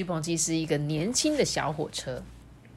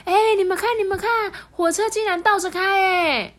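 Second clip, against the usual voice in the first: much higher, excited exclamation; amazed tourists; 14 semitones higher, 5 dB louder than usual. Acted. Tourists on the platform marvelling at the backwards train, much higher. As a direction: gasp at the train going backwards. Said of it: "游客就说".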